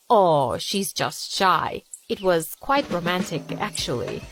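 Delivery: put-on affected British accent. Scott spinning their love story, British accent. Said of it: "British accent".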